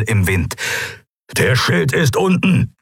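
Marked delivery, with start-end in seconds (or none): 1.4-2.8 grollend. A narrator's reading. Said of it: "grollte Keru".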